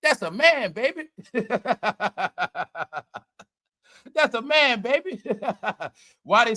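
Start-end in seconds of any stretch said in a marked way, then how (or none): 0.0-1.1 mock commenter. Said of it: "What's that post?". 4.1-5.2 mock commenter. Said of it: "What's that post?".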